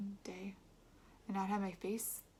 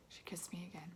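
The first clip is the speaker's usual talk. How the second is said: ordinary delivery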